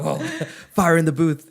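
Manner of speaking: big up the mate's bars with laughter; hype shout. Pretend.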